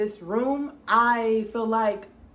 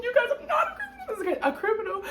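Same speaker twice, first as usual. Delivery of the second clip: mock indignant laugh; 14 semitones higher, faster than usual. Mocking how she was treated.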